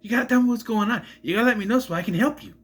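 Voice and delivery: put-on high, urgent over-the-top Boston accent; Mark Wahlberg. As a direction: do an exaggerated, frantic Boston tough guy. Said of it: "sound like Mark Wahlberg".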